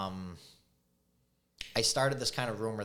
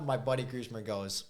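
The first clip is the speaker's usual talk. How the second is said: ordinary talk, nothing marked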